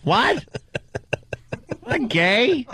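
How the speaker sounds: high, stunned question; shocked. Acted. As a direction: blurt it out in disbelief. Shocked at the rumor.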